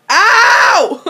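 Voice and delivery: sharp high yelp; yelp of pain. Real, not acted. Fan yelps at a sudden hit, sharp high ow. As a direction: cry out as if hurt.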